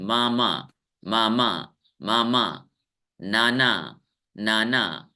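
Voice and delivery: Mama and Nana level and low, both syllables equally long; even stress. Shown as a demonstration. Teacher shows students Hindi's balanced syllables, evenly stressed. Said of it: "ऐसे बराबर होता है".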